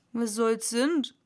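rustic dialect servant; servant's voice. A narrator's reading. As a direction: answer dully in broad dialect. Servant answers master in dialect.

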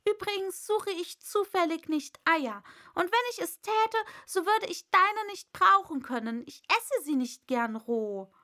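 put-on huffy girl's comeback; indignant Alice. A narrator's reading. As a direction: answer back pointedly, settling at the end.

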